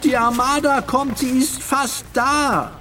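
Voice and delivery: very high, desperate cry; frantic boy's high-pitched yell; calling out. Acted. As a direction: call the warning while being dragged off. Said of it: "rief der Junge".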